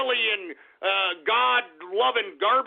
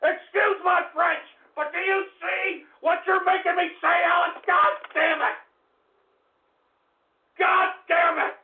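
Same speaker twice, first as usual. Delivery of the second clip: furious yelling; 8 semitones higher than usual. Acted. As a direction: scream it at Alex, out of control. Host enraged at Alex Jones.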